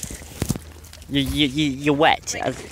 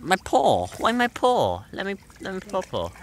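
higher, whiny dog voice; voicing the dog; 7 semitones higher than usual. Owner voicing the dog fretting over its paw, higher. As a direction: speak as the dog fussing about its paw. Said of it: "He's like".

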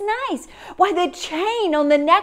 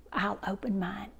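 whispered aside; 5 semitones lower, 11 dB quieter than usual. Acted. Mother quietly promising her kids to open hers last, whispered. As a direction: drop to a breathy whisper.

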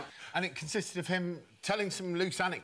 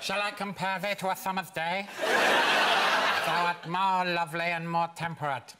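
Chris Eubank impression; 3 dB louder than usual. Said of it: "classic works of literature sound better through Eubank's voice".

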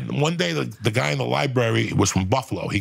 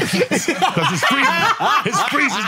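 laughing; 4 dB louder, much slower than usual. Real, not acted. Laughing while telling it.

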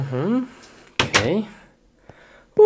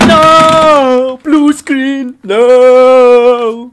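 despairing shout; 10 semitones higher, 14 dB louder, much slower than usual. Real, not acted. Blue screen crash.

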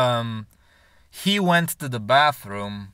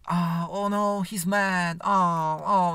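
imitating girlfriend; 5 semitones higher, much slower than usual. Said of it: "his girlfriend was like".

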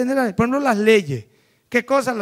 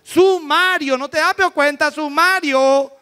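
higher and louder, stubborn insistence on Sumario; stubborn insisting; 5 semitones higher, 3 dB louder, slower than usual. Retold or imitated. Stubborn man insisting to the lawyer, mock stubborn. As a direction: drag out Sumario as if obvious.

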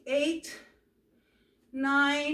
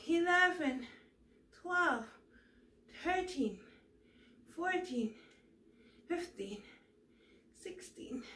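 straining from exercise; 2 semitones higher, 3 dB quieter than usual. Real, not acted. Counting reps under strain.

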